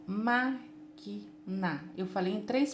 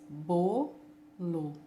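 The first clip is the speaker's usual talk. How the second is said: "bolo" in two slow, separate pieces; syllable by syllable; 4 semitones lower than usual. Shown as a demonstration. Teacher counts two parts for pupils, splitting "bolo" into bo, lo. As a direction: say "bolo" as bo, pause, lo. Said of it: "Eu falo duas vezes".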